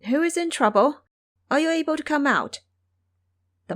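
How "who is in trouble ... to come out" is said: high, loud call, fast on the second question. Acted. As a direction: call into the wilderness to find the voice. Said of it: "turned the fine horse around and called".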